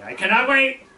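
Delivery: high, loud French-Canadian eagerness; thick French accent. Retold or imitated. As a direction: burst out in a hammed-up French accent. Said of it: "very thick French accent".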